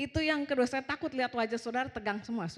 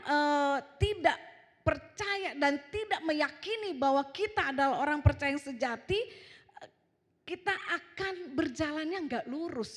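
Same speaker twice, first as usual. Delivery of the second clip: raised, insistent preacher's voice; khotbah lantang; 6 semitones higher, 5 dB louder than usual. Real, not acted. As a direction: urge the congregation to believe. Berkhotbah lantang. (seruan khotbah yang mendesak)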